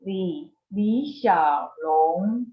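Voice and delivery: Li Xiaolong said slowly in careful Mandarin; slow Mandarin name. Shown as a demonstration. Teacher to class: slow model name. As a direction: pronounce Li Xiaolong slowly for learners. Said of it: "Bruce Lee's Chinese name is".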